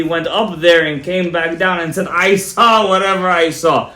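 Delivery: loud preacher's delivery, key words stretched out; emphatic preaching. Real, not acted. Rabbi answering a doubter before listeners, emphatic preaching. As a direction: drive each point home to the congregation.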